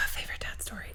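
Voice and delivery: real whisper, thinking it over; whispered musing while searching memory; whispering to herself. Real, not acted. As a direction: mutter the question back under your breath. Thinking aloud on the spot.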